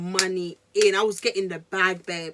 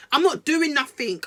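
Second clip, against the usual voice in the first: ordinary talk, nothing marked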